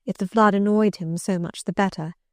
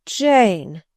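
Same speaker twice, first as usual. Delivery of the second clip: louder, pleading ache on the name; the second Jane swells with bitter pleading; bitter sadness, rising; 5 dB louder than usual. Acted. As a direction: call her name again, anguished and stronger. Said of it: "in such an accent of bitter sadness".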